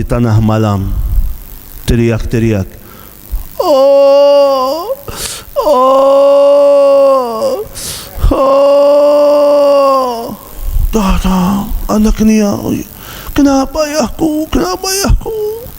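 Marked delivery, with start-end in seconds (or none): 3.6-10.4 high pained cries growing into a shout; old man crying out. Retold or imitated. 13.3-15.7 high anxious question; worried child. Retold or imitated. Children ask about their father.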